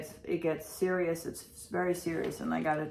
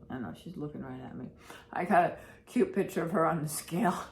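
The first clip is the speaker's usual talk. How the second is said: ordinary talk, nothing marked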